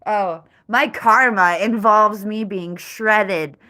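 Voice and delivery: loud, slow mock lament; dramatic. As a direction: overdo the tragedy, loud and slow. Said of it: "That sounds kind of dramatic".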